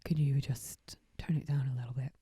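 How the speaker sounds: hushed, polite waiter asking them to quiet down; polite maitre d'. Retold or imitated. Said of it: "a maitre d' or something coming across and saying".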